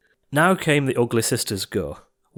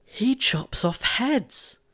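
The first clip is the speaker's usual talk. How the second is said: appalled Cinderella; 7 semitones higher than usual. A narrator's reading. Cindy thinking to herself, high and appalled. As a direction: think it in dismay. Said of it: "she thought".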